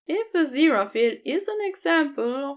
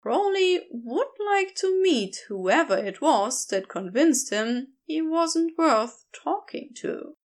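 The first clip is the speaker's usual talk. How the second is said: plain reading voice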